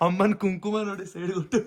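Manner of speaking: high-pitched, laughing tease; amused laughter. Real, not acted. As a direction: giggle through it, pitched right up. Laughing at mother's smudged kumkum. (ನಗುತ್ತಾ ಎತ್ತರದ ದನಿಯಲ್ಲಿ)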